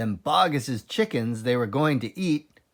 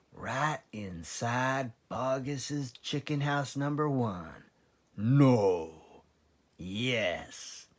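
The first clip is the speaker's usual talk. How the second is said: hushed; 5 dB quieter, much slower than usual. A narrator's reading. Secret exchange in hushed tones.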